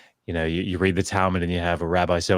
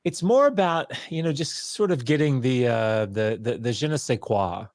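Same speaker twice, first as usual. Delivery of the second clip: imitating the advisor; 6 semitones higher than usual. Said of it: "And he said".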